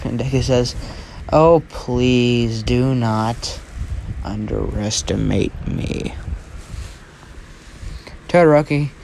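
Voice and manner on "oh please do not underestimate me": very slow, low and softening; slow, lowered, deliberate threat; measured warning. Acted. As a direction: draw every word out as a quiet warning. Warning a rival before fight.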